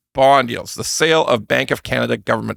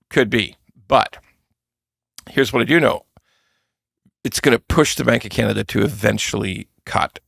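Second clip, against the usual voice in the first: ordinary delivery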